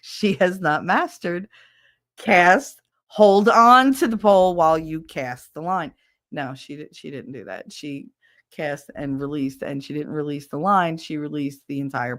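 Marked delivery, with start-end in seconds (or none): 2.2-5.9 loud, hammered-out how-to; emphatic instruction. Acted. Explaining what the friend never mastered, exasperated and loud. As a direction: drive home the basic rule, loudly.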